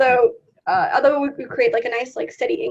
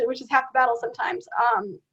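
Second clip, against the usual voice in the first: normal talking voice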